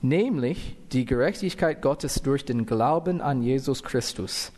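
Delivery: usual speaking voice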